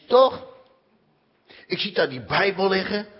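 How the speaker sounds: ordinary talk, nothing marked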